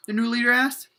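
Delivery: usual speaking voice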